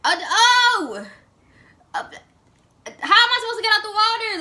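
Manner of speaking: retelling being trapped under the surface, high and rushed; trapped panic. Retold or imitated. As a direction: gasp it out fast, trapped. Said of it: "And I'm just like".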